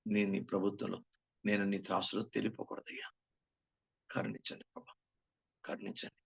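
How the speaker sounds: soft earnest prayer to the Father. Acted. Preacher praying aloud to the Father before the congregation, softly. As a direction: pray it humbly, half aloud. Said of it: "నేను మీరు కూడా నేర్చుకుందాం".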